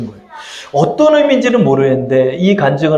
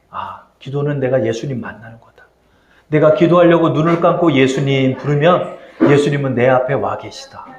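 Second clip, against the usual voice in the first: ordinary talk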